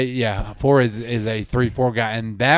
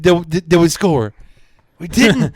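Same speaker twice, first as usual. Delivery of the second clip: higher, louder mock gasping voice; imitating a winded quarterback; 6 semitones higher, 7 dB louder than usual. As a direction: play the dazed, breathless quarterback, hammed up. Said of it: "He said".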